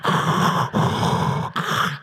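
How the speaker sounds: loud, heavy rasping breaths; masked villain breathing. Acted. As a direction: suck air noisily through an imagined mask. Imitates villain's mask breathing.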